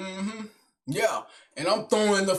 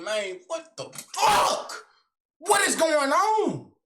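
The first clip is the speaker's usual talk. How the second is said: inner disbelief; 5 semitones higher, slower than usual. Retold or imitated. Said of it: "saying in my head".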